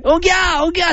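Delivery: high, sustained imitated newborn cry; mock glass-breaking baby wail. Imagining baby's cry shattering glass, mock wail. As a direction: wail a piercing pretend "ogyaa". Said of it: "それでほんまに割れたらね".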